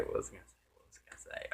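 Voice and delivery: fading, barely voiced self-questioning; muttering to self. Real, not acted. Speaker trying to recall a lost thought, muttering to self.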